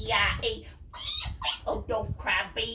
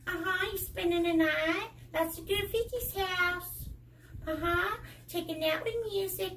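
Bear on the phone. Pretend. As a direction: play the teddy bear chatting on the phone. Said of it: "Bear go".

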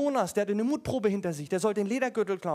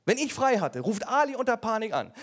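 ordinary talk, nothing marked

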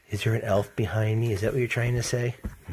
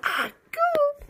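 high squeaky agoo; baby babble. Retold or imitated. Dad cooing back the baby's babble in a high voice. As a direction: copy the baby's coo up high.

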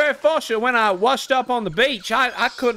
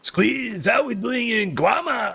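commander voice; slower than usual. Acted. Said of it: "I'm just doing my commander impression".

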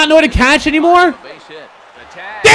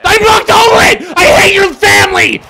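high, anguished rage at the game; high-pitched angry protest; real angry yelling; 5 semitones higher, 4 dB louder than usual. Real, not acted. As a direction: wail it in furious frustration. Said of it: "Fuck, I'm getting angry".

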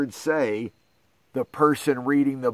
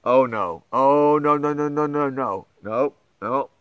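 mock dread. Dread before silly sounds.

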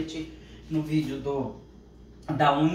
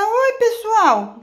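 very high, loud squeaky dog voice; voicing the dog; 12 dB louder, much slower than usual. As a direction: make the dog greet everyone, slowly. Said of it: "Fala, fala". (voz fininha do cachorro dizendo oi)

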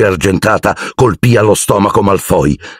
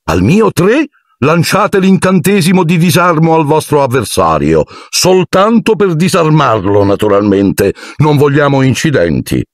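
high, showy instructor voice; pompous teacher; 9 semitones higher than usual. A narrator's reading. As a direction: lecture the duelists grandly. Lockhart instructs the duel. (insegnante vanitoso, voce alta)

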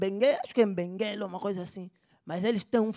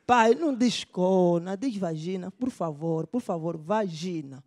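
slow patient correction; 3 semitones lower, slower than usual. Acted. Son correcting father again, patiently.

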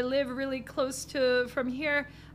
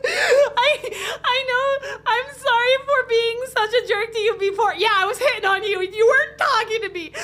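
much higher, louder laughing voice; high character apology; 8 semitones higher, 7 dB louder than usual. Acted. Driver apologizing for hitting on her, much higher and louder. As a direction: squeak out the apology half laughing.

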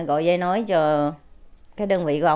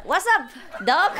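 buddy greeting; 10 semitones higher than usual. Retold or imitated. Soldier greets caller as buddy.

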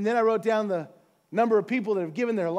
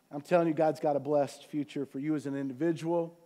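usual speaking voice

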